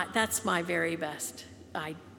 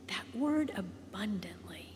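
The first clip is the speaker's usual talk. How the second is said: That word abundantly, whispered and much softer; 6 dB quieter than usual. Shown as a demonstration. Preacher savouring a Bible word for the congregation, whispered.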